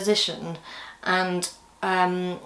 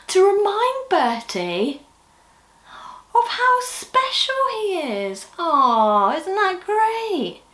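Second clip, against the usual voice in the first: put-on high, slow, wonder-filled children's presenter voice; delighted wonder; 11 semitones higher, slower than usual. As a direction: savour every word like a lovely surprise. Puppet show to children.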